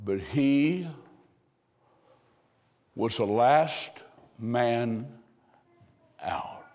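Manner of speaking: one phrase at a time, fading away; dramatic pauses. Real, not acted. Dramatic climax of the story.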